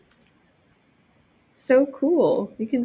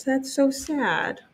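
sympathetic sad; 7 semitones higher, slower than usual. Real, not acted.

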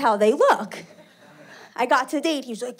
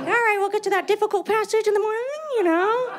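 high, fast, prim reader voice; mock prim book voice; 9 semitones higher, faster than usual. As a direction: say it like a fussy librarian.

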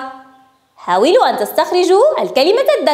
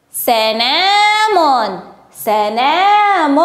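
very slow, syllable by syllable; slow sounding-out; much slower than usual. Shown as a demonstration. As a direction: stretch the word so each sound stands out. Teacher stretches word for sound spotting.

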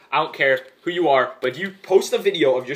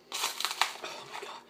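whispered; 13 dB quieter, much slower than usual. Real, not acted. Looking at the coated candy.

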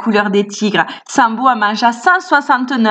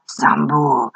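voix de tigre grave et lente; tigre qui gronde; 7 semitones lower, slower than usual. A narrator's reading. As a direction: gronde comme un tigre menaçant. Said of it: "un tigre qui gronda". (un tigre qui gronde, voix basse et lente)

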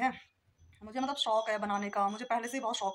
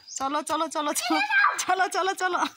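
loud, higher urging calls; calling out; 9 semitones higher, 12 dB louder than usual. Real, not acted. Woman loudly urging someone to move along.

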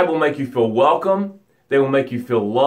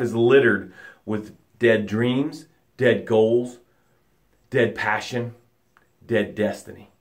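slow, lower, growing softer; fading grimly; 6 semitones lower, 4 dB quieter, slower than usual. Acted. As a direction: let each dead thing sink away. Bottom of ocean, dead dreams.

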